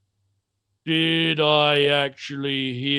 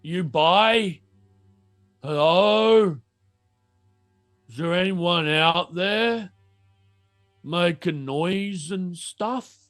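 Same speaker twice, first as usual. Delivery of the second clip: mutant shopkeeper; much slower than usual. Acted. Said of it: "He looks over and is like".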